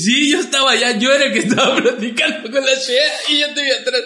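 animated quote with a laugh inside; laughing retelling. Retold or imitated. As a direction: voice the eyewitness while cracking up. Retells friend confirming it, laughing.

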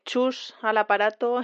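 usual speaking voice

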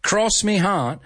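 high British "Cross me heart", r dropped; British accent. Shown as a demonstration. Teacher quoting the mistake, high British accent. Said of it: "I'm trying to be British here".

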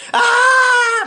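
long, piercing high wail; re-enacted scream. Recalls bleeding heavily from the head, wailing.

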